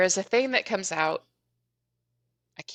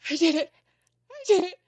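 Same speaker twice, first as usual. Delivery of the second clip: high-pitched, half-sobbing cries of joy; reliving the win, tearful and high; retold joyful sobbing; 13 semitones higher than usual. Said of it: "bawling my eyes out".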